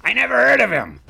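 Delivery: high, loud mock exec, baffled by the name; squeaky, blank Hollywood executive; clueless studio executive. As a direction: ham up a studio boss who's never heard of him. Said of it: "most studio executives now be like".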